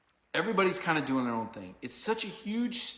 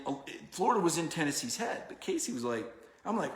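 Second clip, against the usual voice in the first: ordinary talk, nothing marked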